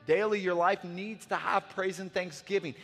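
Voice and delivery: fiery preaching. Real, not acted. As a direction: preach it with rising fire. Preaching builds.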